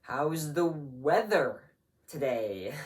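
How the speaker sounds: change the subject abruptly, dropping away on "today"; forced small talk that trails off slowly; awkward topic switch. Acted. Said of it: "you quickly switch the topic to".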